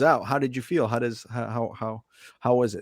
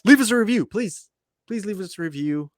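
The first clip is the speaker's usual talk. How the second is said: much higher, louder plea that softens into please; begging listeners; 8 semitones higher, 4 dB louder than usual. Real, not acted. Host asking listeners for reviews, much higher begging plea. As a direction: beg the audience for a review. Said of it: "I beg".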